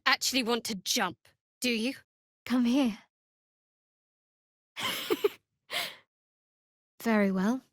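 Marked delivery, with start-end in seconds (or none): 4.8-5.3 light high amused laugh; a quick laugh at the companion's antics; short laugh. Acted. Cleric laughing at companion, short and light.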